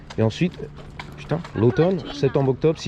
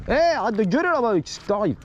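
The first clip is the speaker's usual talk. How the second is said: higher, teasing call across the street; 10 semitones higher than usual. Real, not acted. Kids yelling nearby.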